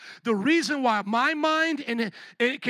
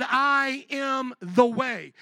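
very slow, each word drawn out; emphatic declaration; 2 semitones higher, much slower than usual. Retold or imitated. Preacher quoting Jesus to the congregation, slow emphatic declaration. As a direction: proclaim it word by word, weightily.

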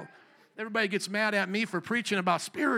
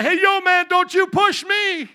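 much louder and higher yell, slowed; shouting down the block; 11 semitones higher, 12 dB louder, slower than usual. Shown as a demonstration. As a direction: call it out to someone far down the street. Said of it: "if you way down the block".

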